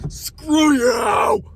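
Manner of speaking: high, loud outburst; angry shout. Real, not acted. Driver yells at the confronting woman, angry shout. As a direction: throw it at her, furious. Yelling at Karen.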